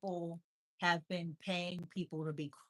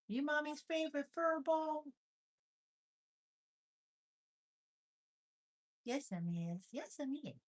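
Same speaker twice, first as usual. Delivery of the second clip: high cooing, answering for the cat; 7 semitones higher than usual. Real, not acted. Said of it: "I talk like that 'cause he likes when I make the funny sounds".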